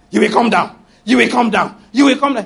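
loud, rising commanding decree; hammed-up decree against the ruler; mock aggressive prayer. Preacher mocking a prayer he never prayed. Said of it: "He didn't say".

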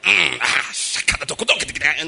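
rattled-off nonsense prayer syllables; grunt, then fire off percussive mock tongues; mock speaking in tongues. Mocking showy preachers praying, rattled-off fake tongues. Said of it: "So it's not about".

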